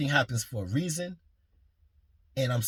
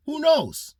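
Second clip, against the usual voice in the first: excited hope; 12 semitones higher, 6 dB louder than usual. Real, not acted. Hopeful Mayweather fight idea.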